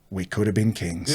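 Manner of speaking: low, soft retold aside; hushed regret for lost glory; quiet wistful regret. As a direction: say it low, as a private lament. Cousin's regretful aside, lounging later.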